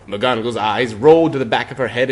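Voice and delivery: plain reading voice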